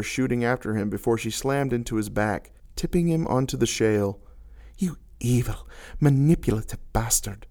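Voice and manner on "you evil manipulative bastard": hissed whisper; whispered hiss of betrayal in his ear. A narrator's reading. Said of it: "she hissed in his ear".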